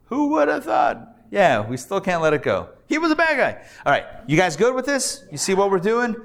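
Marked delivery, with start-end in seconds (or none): 0.1-1.2 high, hammed-up who would have thought; mock amazement. Teacher marvels at the hidden sentence, hammed-up amazed. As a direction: play up the wonder of it.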